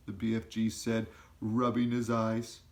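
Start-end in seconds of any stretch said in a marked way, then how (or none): none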